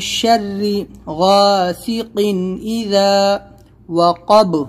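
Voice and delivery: slow, with the final ب of وَقَبَ bouncing; tajweed demo. Shown as a demonstration. Teacher models the verse slowly with qalqalah. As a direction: release the ب in وَقَبَ with an echoing qalqalah. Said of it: "با پر بھی ہم کو قلقلہ".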